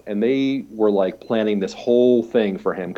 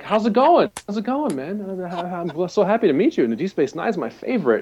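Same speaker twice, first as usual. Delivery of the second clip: high, eager fanboy babble; excited fan gush; 8 semitones higher than usual. Retold or imitated. Fan gushes to Avery Brooks at his table, excited and high. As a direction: gush at your hero, overexcited.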